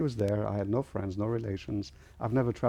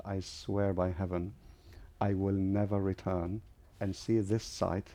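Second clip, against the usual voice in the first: solemn retold vow; 4 dB quieter than usual. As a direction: swear it deliberately, word by word.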